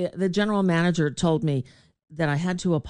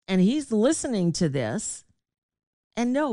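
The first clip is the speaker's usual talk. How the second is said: ordinary talk, nothing marked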